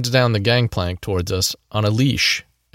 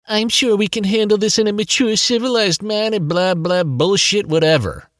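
high whiny mimicking voice; mock him back in a high whine; whiny copycat voice; 11 semitones higher than usual. A narrator's reading. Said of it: "in his whiny copycat voice".

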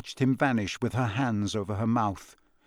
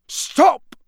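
very high, loud yell; 8 dB louder than usual. A narrator's reading. Father rushing to stop wizards attacking his son, yelling. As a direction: shout to halt an attack. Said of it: "yelled a voice he recognized".

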